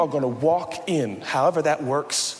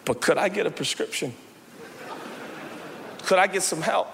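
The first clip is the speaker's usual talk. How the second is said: ordinary talk, nothing marked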